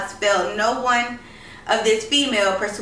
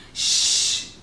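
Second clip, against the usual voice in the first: hushing shh. Acted. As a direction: hush him, don't wake grandma. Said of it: "cautioned his sister".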